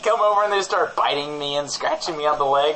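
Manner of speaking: ordinary talk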